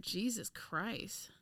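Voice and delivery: soft, slow oath; quiet, drawn-out resignation; deflated dismay. Real, not acted. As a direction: let it sink in under the breath. Sinking in after the mistake.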